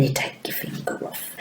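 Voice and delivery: whispered aside. Shown as a demonstration. Teacher whispering a reminder to young pupils. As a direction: say it under your breath.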